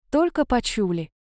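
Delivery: ordinary delivery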